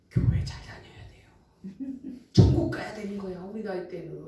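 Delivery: put-on soft hushed old lady voice; quietly urging old woman; whispered advice. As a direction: whisper it like a kindly elder.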